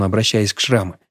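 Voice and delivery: usual speaking voice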